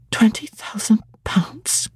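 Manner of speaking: slow whispered "Twenty thousand pounds" in disbelief; stunned whisper. A narrator's reading. Jane repeating her inheritance to herself, whispered. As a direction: breathe "Twenty thousand pounds" slowly, awestruck.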